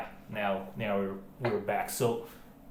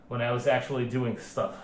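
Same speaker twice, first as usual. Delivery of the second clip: normal talking voice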